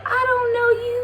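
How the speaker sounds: squeaky and high, near crying; high anxious retort. Retold or imitated. Refusing to close the car door, high and panicky. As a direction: blurt it high and shaky like you're panicking.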